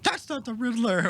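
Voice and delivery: high-pitched mock outcry; put-on high whine of protest; complaining fans. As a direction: imitate outraged fans in a high voice. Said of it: "a lot of people were like".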